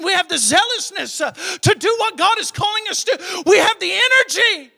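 high, loud, driving sermon cry; full-force preaching. Real, not acted. Pastor urging young believers, loud preaching. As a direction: preach it hard and rising.

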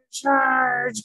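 loud, high drawn-out charge call; battle cry. Acted. Knights attacking the dragon, calling out a battle cry. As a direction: sound the charge, storybook style.

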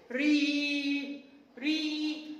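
long, held "ري" as "ree"; kasra sound. Shown as a demonstration. Teacher showing kindergartners the kasra sound, "ري" drawn out as "ree". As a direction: stretch the ee vowel in "ري". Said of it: "يلا نكسر بقنا كده بصوت الحرف".

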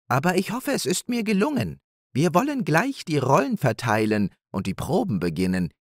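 high, modestly proud hare voice; proud hare Nepomuk. Acted. Hare telling his wife about his play, proudly.

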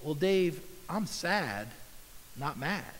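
soft, tender correction; gentle voice of God. Retold or imitated. God gently answering Dave, soft and tender. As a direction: say it softly, like a loving father. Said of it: "something like this".

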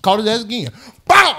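normal talking voice

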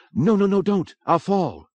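high, urgent pleading; panicked protest. A narrator's reading. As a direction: beg them to stop in alarm. Injured woman begs not to be lowered.

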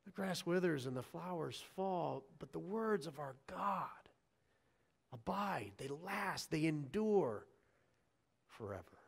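soft, measured recitation with pauses between phrases; solemn recitation. Acted. Preacher closes the scripture reading for the church, hushed and solemn. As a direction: close the reading softly, letting the last word fade.

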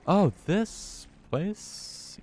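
high, very slow groan of dread; drawn-out dismay. Real, not acted. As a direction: stretch it out in horror. Dismayed return to hated place.

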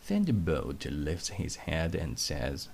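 plain reading voice

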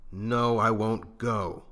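slow, loud, stubborn no; obstinate refusal. A narrator's reading. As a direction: dig in your heels, slowly. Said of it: "his mouth set in an obstinate line".

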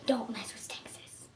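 whispered, soft and low; hushed warning whisper; serious whisper. Shown as a demonstration. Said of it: "but seriously".

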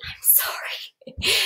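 soft whispered apology, real. Real, not acted. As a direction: whisper a sorry to the listeners. Apologising for kids upstairs.

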